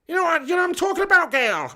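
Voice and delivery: high, quick comic husband impression; badly faked high funny voice, rattled off; Kevin Webster. Streamer as Kevin Webster talking about his missus, high quick impression. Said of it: "I can't do the voice".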